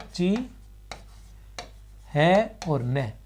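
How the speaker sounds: ची है और न spoken very slowly, pieces apart; syllable by syllable. Shown as a demonstration. Teacher splitting चिन्ह into letters for students, slowly. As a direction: break चिन्ह into its letters, slow. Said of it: "इसको हम ऐसे लिख सकते हैं".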